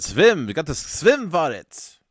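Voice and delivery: loud, high hammed-up German accent; mock German accent. Host voicing Paul's typo vote, mock German accent. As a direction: exclaim it with v for w, German-style. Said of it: "He said".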